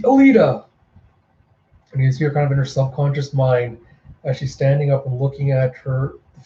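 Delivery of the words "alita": higher call of her name. Acted. Friends calling out as she lies in her mind, high call.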